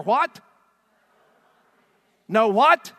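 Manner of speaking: high, loud prompting shout; loud call-out. Real, not acted. Preacher prompting the congregation, shouting. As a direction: call for the answer from the crowd. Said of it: "say it out loud with passion".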